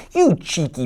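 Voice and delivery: granny. Acted. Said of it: "Granny says".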